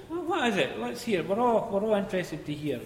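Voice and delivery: pompous under manager answering; mocking manager voice. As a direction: voice the pompous boss.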